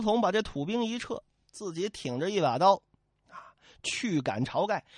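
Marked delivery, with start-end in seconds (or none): none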